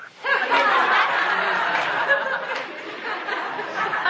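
high, helpless giggle of embarrassment; embarrassed laugh. Real, not acted. Pastor confessing he watches The Bachelor, laughing. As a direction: burst into a sheepish laugh. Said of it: "I've been watching The Bachelor".

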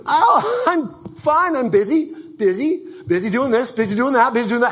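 high, loud, chirpy overbusy friend with a laugh; mock busy person. Preacher mimicking a frazzled busy person answering a greeting, high and loud. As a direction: gush how busy you are, hammed-up.

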